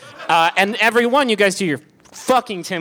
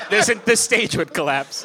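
ordinary talk

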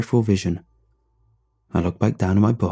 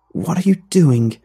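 scared; 7 semitones higher, 5 dB louder than usual. A narrator's reading. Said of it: "It was her turn to sound scared, maybe angry".

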